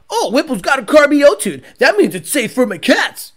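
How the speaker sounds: mock the gullible customer, bright and sure; hammed-up clueless racer reassuring himself; mock naive customer. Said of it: "So you guys think".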